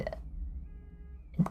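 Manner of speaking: slow secretive whisper. Acted. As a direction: hush it, very slow. Reading a mysterious hint.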